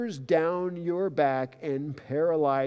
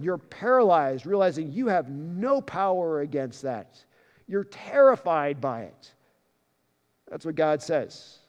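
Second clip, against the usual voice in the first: ordinary talk, nothing marked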